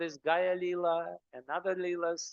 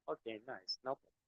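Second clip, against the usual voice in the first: softer, offhand mock reply; offhand, softer shrug of a voice; mock casual; 6 semitones lower, 9 dB quieter than usual. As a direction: toss it off lightly, as if the Lord were at your beck and call. Mocking a casual demand.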